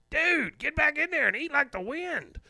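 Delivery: very high, loud mock yell; hammed-up high-pitched urging. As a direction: yell it in a screechy high put-on voice. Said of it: "[name], of course".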